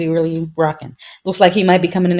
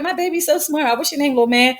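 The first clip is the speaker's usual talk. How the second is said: proud parent; 6 semitones higher than usual. Retold or imitated. Mimicking proud parent, asking child.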